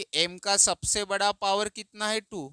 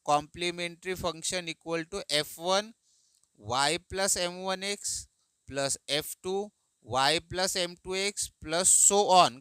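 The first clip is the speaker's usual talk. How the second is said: slow formula dictation; much slower than usual. Shown as a demonstration. Teacher dictating a formula to students, slowly. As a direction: read the formula out slowly for copying. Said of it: "क्या है formula?".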